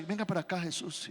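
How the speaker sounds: soft, pulling him aside confidentially; quiet urging, as if drawing Jesus away; hushed plea aside. Retold or imitated. Pedro pulls Jesus aside.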